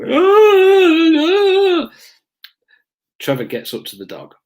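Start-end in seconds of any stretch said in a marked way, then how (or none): 0.0-1.9 high, loud drawn-out wail; imitated pained moaning. Suspect hurt by the dog's grip, moaning loudly. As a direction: moan like someone in a dog's grip. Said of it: "you can imagine the noise".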